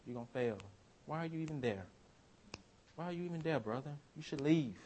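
hushed, taunting murmur; quiet mocking tempter; enemy's voice. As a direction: whisper doubts into his ear. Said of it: "And then it came".

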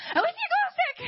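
high-pitched excited burst; thrilled squeal. Real, not acted. Storyteller spots the first glow stick, squealing with excitement. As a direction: squeal it, close to giggling.

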